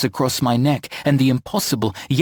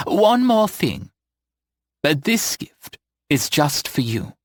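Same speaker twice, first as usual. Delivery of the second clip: tender; 4 semitones higher, slower than usual. Acted. Eleanor offering secret gift.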